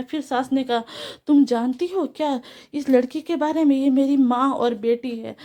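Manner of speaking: ordinary delivery, nothing marked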